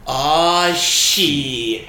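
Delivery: long, drawn-out mock yell; loud, stretched-out quote, falling away at the end; imitated movie yell. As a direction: yell it out slowly like a doomed film character. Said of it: "he just yells".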